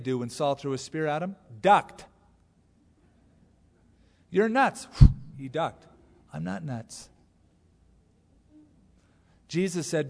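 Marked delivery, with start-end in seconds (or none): 4.3-4.9 high, raised jeer; high-pitched mock attack; mock accusation. Accusation thrown like a spear. 6.3-7.1 quiet, mild denial; reply softly after ducking the insult; soft answer. Retold or imitated. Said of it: "A soft answer turns away wrath".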